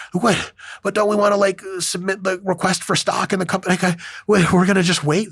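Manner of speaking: high, whiny impatient investor; high pitched mock protest; mock impatient whine. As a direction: whine it like you can't stand waiting.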